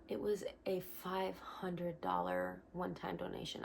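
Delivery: whispered in disbelief, barely voiced; hushed whisper. Whispering about the surprise donation in her email. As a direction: breathe the news out like a secret.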